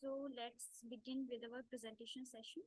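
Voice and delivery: very faint, low voice; speaking too far from the mic; barely audible. Real, not acted. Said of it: "Ma'am, your voice is very low".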